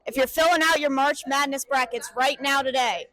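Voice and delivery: a burst of loud, fast energy on the first word; bright hype-man kickoff to a segment question; on-camera host greeting that settles quickly; upbeat host opener. Acted.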